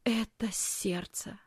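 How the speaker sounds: slow, soft whisper. A narrator's reading. Dying aunt realising it is her heart, whispering. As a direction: whisper it with failing strength. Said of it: "вдруг прошептала тётя".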